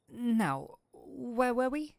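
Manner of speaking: ordinary talk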